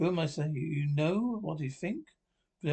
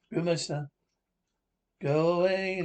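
ordinary delivery

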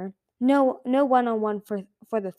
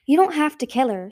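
higher, louder urgent protest; 4 semitones higher, 6 dB louder than usual. A narrator's reading. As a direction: object out loud, pitch raised. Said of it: "Sunny protested".

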